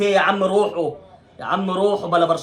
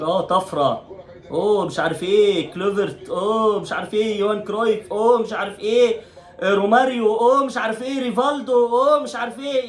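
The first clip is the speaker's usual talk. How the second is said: mock fan hype climbing ever higher; imitating fans; 5 semitones higher than usual. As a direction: parrot the nostalgic fans, rising with each name. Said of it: "ناس تقول لك". (تقليد ساخر للمشجعين بصوت يعلو تدريجيا)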